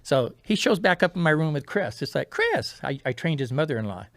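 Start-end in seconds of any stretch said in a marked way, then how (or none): 2.3-2.8 surprised. Retold or imitated. Surprised greeting of surgeon.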